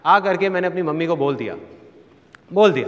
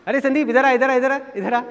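put-on high-pitched summons to a child; aunt calling out; 8 semitones higher, 3 dB louder than usual. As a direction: imitate an auntie calling her nephew over. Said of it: "मेरी मामी जी आवाज़ लगाती थी". (मामी की ऊँची आवाज़ में पुकार)

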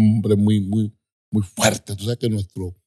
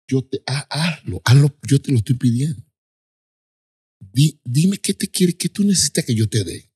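higher, urgent begging; imitated pleading father; 5 semitones higher than usual. Father begging his child to leave the faith, urgent imitated plea. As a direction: plead insistently, pushing the words out. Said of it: "Así".

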